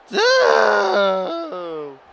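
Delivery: high shouted cry of no; anguished shout. Real, not acted. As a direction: scream the no in despair. Near fall reaction.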